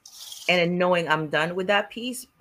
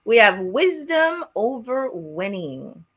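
announcer; 4 semitones higher, slower than usual. Acted. Announcing the day's theme.

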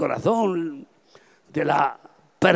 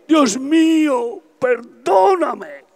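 high, slow, anguished cry; reliving falling to the floor in tears, a high slow cry to God; 8 semitones higher, 3 dB louder, much slower than usual. Retold or imitated. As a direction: wail for forgiveness. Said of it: "y gritando".

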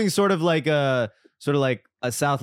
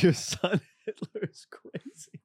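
laughing; 5 semitones higher, 5 dB quieter than usual. Real, not acted. Laughing disbelief.

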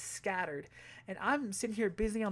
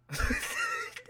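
shrieking laugh; 4 dB louder than usual. Real, not acted. Laughing at own lie.

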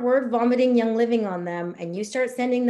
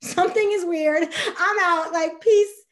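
higher, louder, alarmed bailing out; mock freaked-out; 7 semitones higher, 6 dB louder than usual. Prospect fleeing what sounds like a cult, mock alarm. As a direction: back off in mock alarm, rising to a yelp. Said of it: "They're gonna be like".

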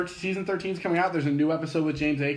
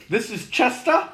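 put-on higher, slower British accent; 8 semitones higher than usual. Comedian introduces a boy on a panel show, British accent. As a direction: imitate a British man introducing someone. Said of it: "in a British accent".

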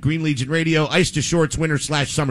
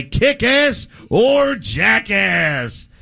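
announcer voice; 4 semitones higher, much slower than usual. Acted. Segment title announced.

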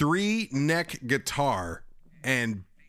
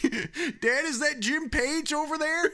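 put-on excited kid; 14 semitones higher than usual. Acted kid calling his dad.

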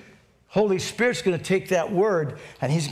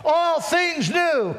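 high, drawn-out preaching cry; triumphant preacher's shout; 10 semitones higher, much slower than usual. Real, not acted. Pastor crowning his scripture recital, triumphant shout. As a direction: shout it slowly and high to the congregation.

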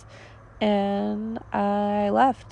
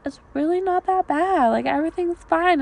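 higher-pitched imitation of brushing it off; mock reassurance in a raised pitch; mock placating; 7 semitones higher than usual. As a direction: play the ex insisting all is well, pitched up. Acting ex in retold argument.

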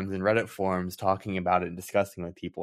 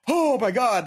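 high, louder thrilled outburst; excited gasp; 5 dB louder, slower than usual. Retold or imitated. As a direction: blurt it out in giddy amazement. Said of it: "I saw it and I went".

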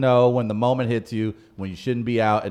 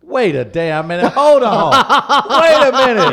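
hammed-up outraged yelling, stretching the words; mock outrage; 14 semitones higher, 11 dB louder than usual. Host protests being outed for sleeping in a club, mock outrage.

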